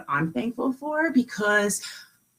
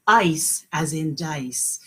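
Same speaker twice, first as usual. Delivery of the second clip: slow model phrase; 5 semitones lower, slower than usual. Shown as a demonstration. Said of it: "Can you guys say that?".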